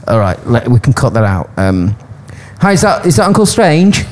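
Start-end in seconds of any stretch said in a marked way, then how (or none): 2.6-4.0 higher, nervous caller voice. Acted. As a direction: play the anxious caller. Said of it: "Yeah, shall I be the caller?".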